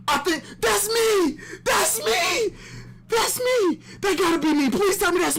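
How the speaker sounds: yelling way up high, thrilled; excited shout. Real, not acted. Fan shouting at his own cameo in the comic. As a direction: shout it out, beside yourself with joy.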